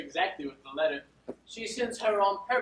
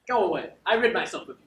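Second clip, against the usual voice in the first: normal talking voice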